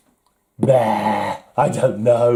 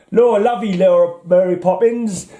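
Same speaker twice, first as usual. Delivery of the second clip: high, hammed-up corny English accent, Mary Poppins style; mock cockney charm in a corny English accent; 5 semitones higher than usual. Mocking Marvel's corny English accent in comics. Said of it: "really corny English accent".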